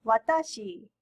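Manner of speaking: "watashi" with uneven, moving pitch; foreigners' watashi. Shown as a demonstration. Teacher showing learners what not to say, "watashi" with un-Japanese stress. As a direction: say "watashi" with the middle syllable stressed, not flat. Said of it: "many foreigners say watashi".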